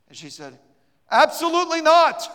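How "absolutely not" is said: high, loud flat refusal; emphatic refusal. Retold or imitated. A woman bluntly refuses the preacher, loud and high. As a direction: slam the door on it, loud and high.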